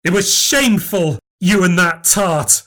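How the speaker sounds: furious grievance. Acted. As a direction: pour out a lifetime of betrayal. Long furious marital grievance.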